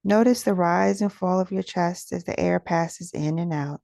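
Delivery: usual speaking voice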